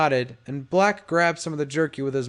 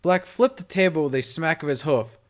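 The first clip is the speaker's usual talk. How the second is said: ordinary delivery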